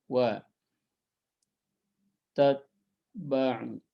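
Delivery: word by word, drawn out; dictating the verse word by word; slow recitation. Shown as a demonstration. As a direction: recite it piece by piece slowly.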